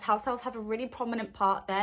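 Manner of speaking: ordinary talk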